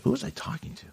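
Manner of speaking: whispered aside to herself; whispering. Real, not acted. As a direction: breathe the question almost silently. Wondering aloud to herself.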